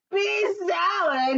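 high, drawn-out outraged shout; indignant cry. A narrator's reading. Giant reacting to his wife's bean salad, indignant cry. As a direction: cry it out slowly in disbelief. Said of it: "he cried".

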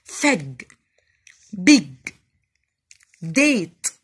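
one firm, unbroken syllable per word; teacher modelling one-syllable words. Shown as a demonstration. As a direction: say each word in a single beat, separated by pauses. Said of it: "لأ، بنطقها على بعض".

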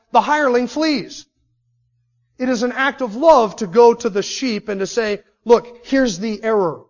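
fiery sermon delivery; preaching at full force. Acted. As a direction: preach it hard and high.

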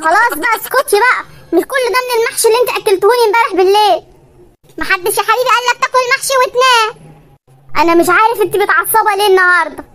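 ordinary delivery

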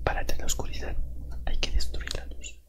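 hushed unvoiced whisper. Hiding from his captor, whispering so she can't hear. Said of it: "No puedo hablar muy fuerte".

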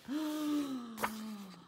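long dramatic gasp; suspenseful gasp. Acted. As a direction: gasp in expectation for the listener. Storyteller anticipates Betty's rescue.

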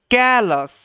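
ক্যালাস said clearly, ending in "as"; careful pronunciation demo. Shown as a demonstration. Teacher reading a new word to students, careful pronunciation demo. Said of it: "আস, এরমভাবে উচ্চারণ হবে".

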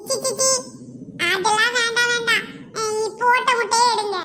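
put-on high childish yuck, then a giggle; mock-childish grossed-out voice; disgusted child. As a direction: squeal in disgust like a little boy.

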